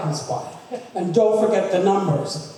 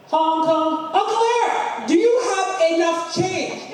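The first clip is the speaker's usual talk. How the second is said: high laughing shout; 10 semitones higher than usual. Retold or imitated. Shouting to Uncle Eric at the meter, laughing yell. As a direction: holler at him through a laugh.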